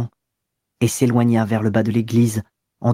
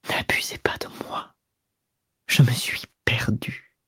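whispered, breaking, like a moan; low broken voice; 6 dB quieter than usual. A narrator's reading. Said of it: "d'une voix basse et brisée".

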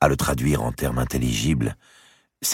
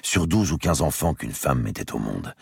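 plain reading voice

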